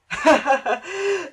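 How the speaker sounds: high, loud mocking giggle; children's laughter. A narrator's reading. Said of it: "The children began to laugh".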